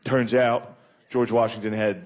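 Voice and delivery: ordinary talk, nothing marked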